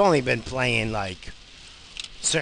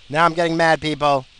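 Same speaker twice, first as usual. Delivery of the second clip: louder, higher, real irritation; getting mad; 5 semitones higher, 8 dB louder than usual. Real, not acted. Cut-off driver warning people, getting mad out loud. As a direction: raise the voice, temper rising.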